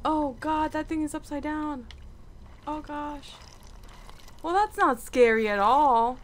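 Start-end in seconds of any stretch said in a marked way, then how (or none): none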